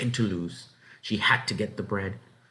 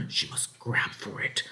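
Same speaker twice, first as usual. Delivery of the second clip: whispered, softer resolve; urgent whisper; 3 semitones higher, 4 dB quieter than usual. A narrator's reading. Narrator hushes as the girl steels herself, whispering. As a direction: breathe it tensely.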